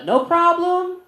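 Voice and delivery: very high, uneasy squeak; high-pitched awkward politeness; awkward acceptance. Retold or imitated. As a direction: jump way up in pitch, mock-agreeable. Awkward reply to student's praise.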